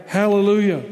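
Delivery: ringing but controlled exclamation; crying out. A narrator's reading. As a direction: read it as a multitude's cry, with restraint. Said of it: "Once more they cried out".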